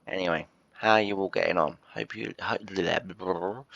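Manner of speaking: normal talking voice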